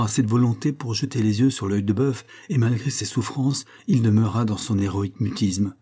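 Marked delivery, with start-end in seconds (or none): none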